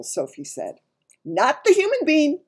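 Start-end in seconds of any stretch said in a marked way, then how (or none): 1.2-2.4 loud, high objection; indignant giant. A narrator's reading. Giant contradicting Sophie, loud and high. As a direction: object loudly in a big giant voice.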